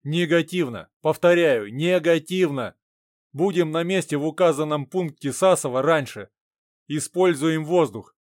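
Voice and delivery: loud, firm radio reply; over walkie talkie. Acted. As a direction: refuse firmly over the radio.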